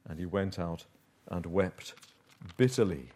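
low, soft, halting narration; sombre reading. A narrator's reading. Preacher telling how Peter went out in bitter grief, sombre and hushed. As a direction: let each phrase fall quietly, with pauses.

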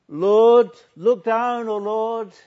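high, very loud plea; ringing, impassioned appeal; crying out to God. Acted. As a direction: call up to heaven at full force. Preacher cries out hymn as plea.

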